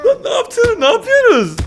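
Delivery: very high, squeaky disbelief; incredulous outburst. Real, not acted. Player reacting to a man fighting his shadow, incredulous outburst. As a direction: blurt it out in high-pitched amazement.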